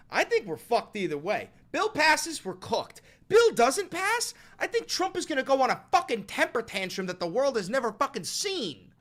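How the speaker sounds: climbing into an alarmed rant; worked-up rant. Real, not acted. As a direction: rant it like doom is certain either way. Ranting about the bill's fate.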